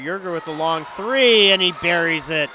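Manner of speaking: rising play-by-play excitement on the made three; excited call. Real, not acted.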